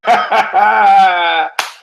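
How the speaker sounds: big high burst of laughter; real, loud belly laugh. Real, not acted. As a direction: crack up at your own joke. Cracks up at own punchline.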